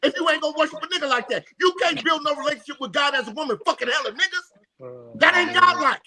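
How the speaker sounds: high, worked-up outrage; indignant rant. Real, not acted. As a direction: rant it, rising and incredulous. Indignant rant about God talk.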